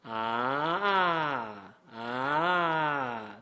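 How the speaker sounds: drawn-out "Ah" rolling over and down; slow demo vowel sliding like a fourth tone; rolled fourth-tone sound. Shown as a demonstration. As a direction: roll the voice out of a rise into a falling "Ah". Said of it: "sound to a Chinese speaker like a fourth tone".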